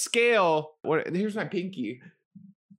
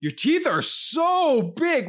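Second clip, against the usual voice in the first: slow, high, louder impressed dentist; 10 semitones higher, 4 dB louder, slower than usual. Retold or imitated. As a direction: draw it out, amazed dentist. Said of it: "He's like".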